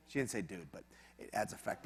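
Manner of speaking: quiet aside. Real, not acted. Aside after quoting Carol.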